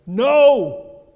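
a preacher's explosive No!; emphatic shout. Real, not acted. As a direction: slam the word down hard. Rejects passive thinking, shouts.

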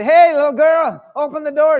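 loud, high call through a door; mock pounding-on-door call. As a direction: shout to the girl behind the door. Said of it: "And he said".

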